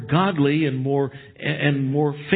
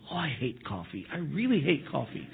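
muttered under the breath, much softer; a soft, smiling mock complaint; silent grumble; 10 dB quieter than usual. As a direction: grumble it to yourself behind a smile. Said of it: "when I do silently".

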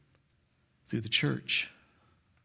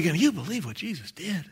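shared amazement; 3 semitones higher, 5 dB quieter than usual. Acted. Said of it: "We're all gonna be".

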